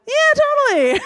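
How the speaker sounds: high, lilting fake yes; breezy fake agreement. Acquaintance brushing off a coffee invite, falsely keen. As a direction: agree far too easily, up high. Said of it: "I'm gonna be like".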